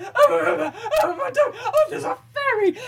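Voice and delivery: high, loud, tearful half laugh; laughing panic cry. Retold or imitated. Being rescued, babbling about the ferry, laughing panic cries. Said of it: "I went".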